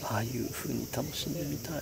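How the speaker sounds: soft, half-whispered night talk; hushed voice. Real, not acted. 夜のキャンプ場、隣に客. (ひそひそ声)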